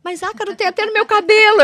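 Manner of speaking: high, loud incredulous protest; exasperated inner voice. Retold or imitated. Doctor voicing a patient's thought, high incredulous exclamation. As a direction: act out your own disbelief at the dust mites. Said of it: "eu penso".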